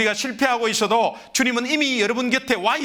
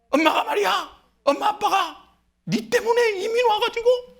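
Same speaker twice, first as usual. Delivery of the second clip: rising mock scolding, higher and higher; a parent losing their temper at the kid; 10 semitones higher than usual. Immigrant parent scolding a child, building to high mock anger. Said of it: "막 퍼붓고".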